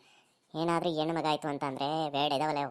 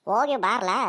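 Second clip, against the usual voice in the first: higher, louder hail to a passing friend; calling out; 6 semitones higher, 6 dB louder, slower than usual. Acted. Villager hailing his friend on the road, loud call. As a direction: call him over loudly, friendly.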